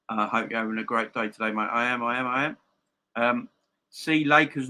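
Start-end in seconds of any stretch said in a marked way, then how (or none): none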